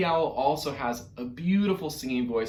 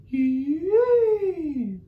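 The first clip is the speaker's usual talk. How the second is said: Mama Owl hoo; 14 semitones higher, 5 dB louder than usual. Shown as a demonstration. Teacher modelling Mama Owl's singing voice. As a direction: float up into a light head voice. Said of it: "Can we all go".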